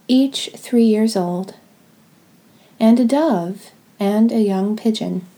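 normal talking voice

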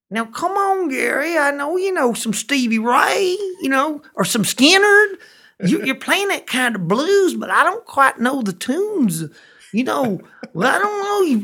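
high, loud, slow yokel voice pleading for covers; pushy bar patron. Retold or imitated. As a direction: play the drawn-out pestering barfly, high and loud.

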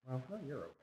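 ordinary delivery

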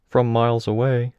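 another irregular. A narrator's reading. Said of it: "one of the other irregulars said".

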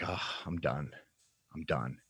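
low, soft, giving up; resigned surrender. Retold or imitated. Caught by the police, he quietly gives up. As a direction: let it all go, quietly done.

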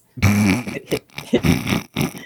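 low, drawn-out snicker; snickering laugh. Real, not acted. Co-host cracks up at rings around Uranus, snickering. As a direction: snicker helplessly at a silly joke.

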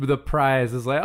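normal talking voice

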